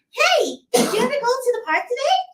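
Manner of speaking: put-on very high excited child voice; little kid voice. Shown as a demonstration. Voice actor demoing an excited child asking about the park. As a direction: squeal it like an eager kid. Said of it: "I know I said".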